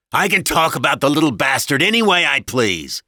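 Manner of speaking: loud, exasperated husband's tirade; angry husband. A narrator's reading. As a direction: rant like a bullying husband.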